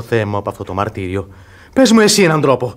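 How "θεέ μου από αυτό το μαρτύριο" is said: low, soft despairing plea to God; anguished sigh. Acted. Lovesick young man groaning to his friend, low and anguished. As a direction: let it sink, lower and softer, in despair.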